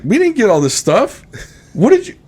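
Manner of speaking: imitating high-pitched disbelief; bewildered wife. Wife looking at stuff they didn't get, high-pitched bewilderment. Wife acted questioning husband over cart.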